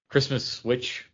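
ordinary talk, nothing marked

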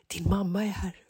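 hushed schoolkid whisper. Retold or imitated. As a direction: tip off a friend on the sly. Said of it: "hör jag hans kompisar så här". (viskande kompisar i klassrummet)

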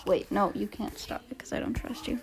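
hushed whisper. Real, not acted. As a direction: whisper it, unvoiced. Whispering so recording continues.

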